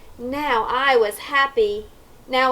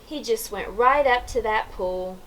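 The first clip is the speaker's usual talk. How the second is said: usual speaking voice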